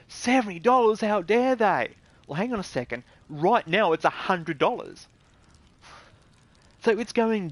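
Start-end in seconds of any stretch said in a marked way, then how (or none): 0.1-1.9 higher, louder indignant whine; mock outraged gamer. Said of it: "They're just going".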